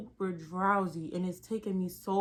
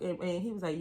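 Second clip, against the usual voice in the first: ordinary talk, nothing marked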